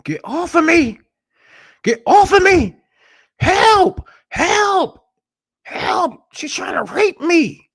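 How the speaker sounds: high, loud, desperate screaming for help; retold screams for help. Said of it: "a woman screaming".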